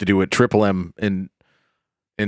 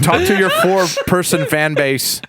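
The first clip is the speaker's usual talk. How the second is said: laughing; 7 semitones higher than usual. Real, not acted. Laughing while addressing listeners.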